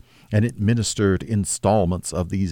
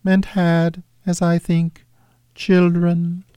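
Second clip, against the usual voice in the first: high, otherworldly spirit voice; ghost voice; 10 semitones higher, 3 dB louder, slower than usual. Acted. Ghost recalling Scrooge's sister, high spirit voice. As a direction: speak as a gentle, distant ghost, pitched high.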